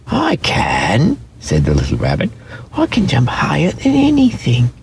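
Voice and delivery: high, small toy-rabbit voice; a shy little rabbit brushing it off. A narrator's reading. Toy rabbit refusing to play, high and small.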